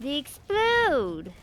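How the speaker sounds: excited shout. Real, not acted. Game enemies blow up.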